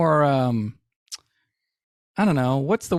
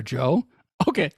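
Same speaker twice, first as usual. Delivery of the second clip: usual speaking voice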